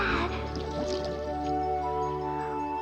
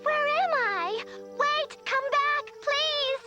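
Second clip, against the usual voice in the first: high, anxious calling out; frightened calling; 8 semitones higher than usual. Acted. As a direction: call after them in fear. Lost, calling after departing swallow.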